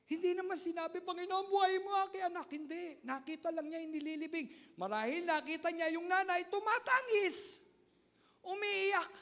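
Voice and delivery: high, loud preaching at full force; fervent preaching. Acted. Pastor urging the congregation with loud fervent preaching. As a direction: preach it with rising fire.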